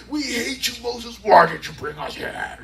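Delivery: mock angry crowd. Voicing angry scared Israelites.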